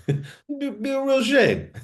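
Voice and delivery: squeaky high mock-sinister voice. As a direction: pitch it way up and drawn-out. Mock reads line in voice.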